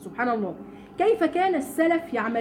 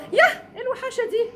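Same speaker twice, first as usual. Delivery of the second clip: much higher, mock-disgusted gasp over the baby; 8 semitones higher than usual. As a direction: sneer at the newborn like a catty visitor. Mocking a newborn's looks. (اشمئزاز ساخر بصوت أعلى بكثير)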